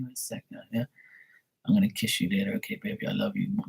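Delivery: hushed whisper so mom won't hear; secret whisper. Retold or imitated. Teen whispering love on the phone so mom won't hear. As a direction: whisper it, hiding from the house. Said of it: "so nobody can hear me".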